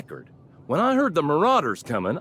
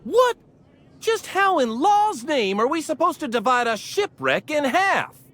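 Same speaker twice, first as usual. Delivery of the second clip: high, incredulous outburst; outraged disbelief; 9 semitones higher than usual. Acted. Salvager challenging the order to split a shipwreck, outraged. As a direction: protest an absurd ruling, pitch shooting up.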